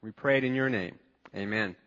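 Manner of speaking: soft, low opening prayer. Acted. Pastor prays over the congregation, softly and low. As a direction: pray it quietly to the Lord.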